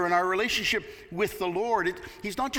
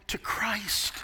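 whisper; 4 semitones higher than usual. Real, not acted. Personal conversion climax, hushed.

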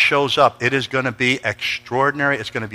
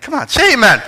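higher, louder call for amen; high, loud call to the congregation; preacher call; 11 semitones higher, 5 dB louder than usual. Real, not acted. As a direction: rouse the room to say amen. Calling congregation to respond.

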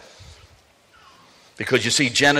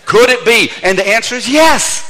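higher and louder, driving it home; emphatic preaching; 9 semitones higher, 6 dB louder than usual. Real, not acted. Preacher answers the congregation, higher and louder. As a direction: proclaim it loud and certain. Preacher rising to full force.

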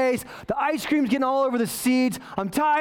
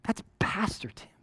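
soft whispered aside, pointing him out; a churchgoer whispers, recognizing the pastor; gossiping whisper; 8 dB quieter than usual. Pretend. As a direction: whisper it like a shocked onlooker. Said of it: "she could have been like".

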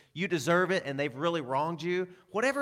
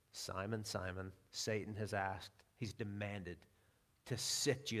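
tender warning; 6 semitones lower, 10 dB quieter than usual. Retold or imitated. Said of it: "And Jesus said".

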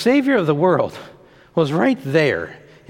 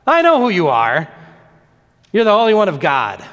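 acted outcry, moderately raised; crying out; 2 semitones higher, 3 dB louder than usual. Possessed man recognizing Jesus, crying out. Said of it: "cries out at the top of his voice".

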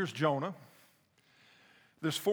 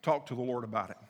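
ordinary delivery

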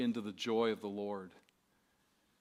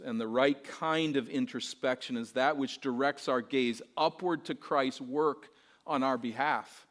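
ordinary delivery

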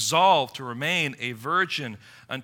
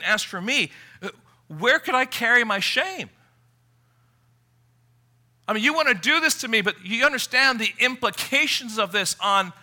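Tamar pleading; 7 semitones higher, 5 dB louder than usual. Retold or imitated. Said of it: "here she is pleading".

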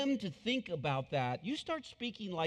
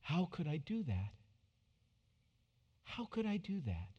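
quiet wondering; 6 dB quieter than usual. Shown as a demonstration. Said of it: "Just stop and think".

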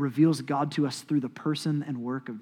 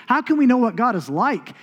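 ordinary talk

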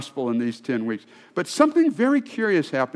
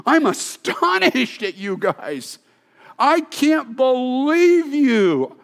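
harsh confrontive Paul; 7 semitones higher than usual. Acted. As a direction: scold them like an outraged apostle. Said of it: "Paul is confrontive, and he's strong, and he's harsh".

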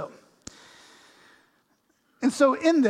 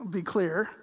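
normal talking voice

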